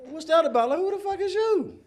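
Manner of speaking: high, loud, laughing disbelief; shocked laughing disbelief. Retold or imitated. Comedian meeting strangers found in his house, laughing shock. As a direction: cackle at strangers coming out of his rooms.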